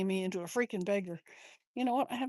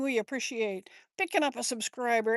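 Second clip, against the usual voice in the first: ordinary talk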